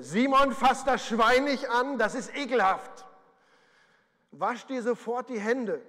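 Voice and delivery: lightly voiced mum telling her boy off; scolding mother. A mother scolds young Simon about the pig, a light mock scold. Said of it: "an die Worte seiner Mama denken".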